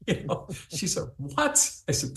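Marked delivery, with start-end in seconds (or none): 1.2-1.6 high, hushed mock disbelief; shocked what. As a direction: imitate the stunned wife on the line. Wife's shocked reply on phone.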